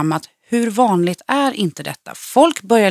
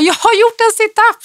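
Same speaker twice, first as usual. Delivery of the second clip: very high-pitched re-enacted triumph; retold elated cry; 13 semitones higher, 4 dB louder than usual. Telling classmates of first sit-up after surgery, high retold excitement. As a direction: squeal it as if overjoyed, retelling.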